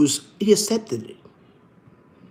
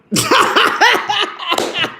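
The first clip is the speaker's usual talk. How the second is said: high cackling laugh. Real, not acted. After telling the wife story, high cackling laugh. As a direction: crack up at your own story.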